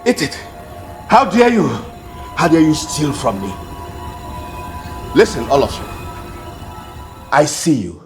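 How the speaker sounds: put-on a cruel mistress punishing a thieving girl; furious scolding. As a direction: force her to eat, enraged. Woman punishing thief, forcing food.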